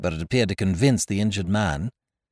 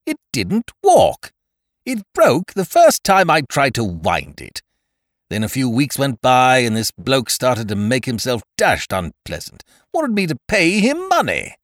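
indignant rant; 5 semitones higher, 6 dB louder than usual. A narrator's reading. Aggrieved Ukridge rants.

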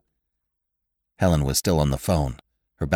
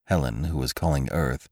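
plain reading voice

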